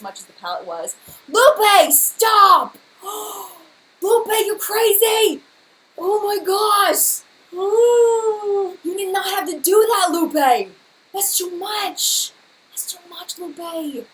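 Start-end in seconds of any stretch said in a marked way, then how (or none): 1.3-10.7 high, loud drawn-out cries of shock; real overwhelmed shriek at a gift; overwhelmed disbelief. Real, not acted. Streamer to a generous viewer, overwhelmed high shouting. 11.1-14.1 real overwhelmed plea, losing volume; overwhelmed protest. Real, not acted. Streamer to a generous viewer, high overwhelmed protest.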